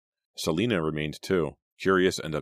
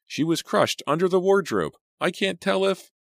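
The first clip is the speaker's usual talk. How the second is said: high, loud shaken voice trailing off; words fade as fear takes over; 5 dB louder than usual. A narrator's reading. Teacher tells the kids the girl was crushed, high and shaken. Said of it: "his voice trailed off".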